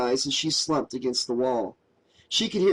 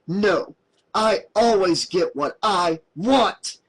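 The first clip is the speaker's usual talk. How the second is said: put-on spoiled child; 4 semitones higher, 4 dB louder, slower than usual. A narrator's reading. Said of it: "like a spoiled child".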